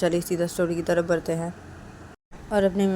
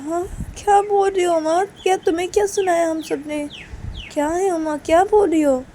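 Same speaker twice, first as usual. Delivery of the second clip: high-pitched questioning; acted dismay at an accusation; taken-aback protest; 10 semitones higher, 5 dB louder than usual. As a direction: object in raised, disbelieving tones.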